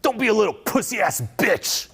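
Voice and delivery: builds from a slow growl to a high yelled Enough; hammed-up self-help rage, rising to a shout; mock angry outburst. Coach yelling at someone stuck in fear, mock angry. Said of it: "moving up higher to anger".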